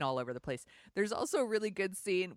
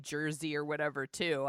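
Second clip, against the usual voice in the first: ordinary talk, nothing marked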